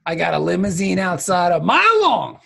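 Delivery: loud brag soaring on MILE; hammed-up boast. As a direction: show off like a flamboyant braggart. Boasting as a flamboyant ladies' man.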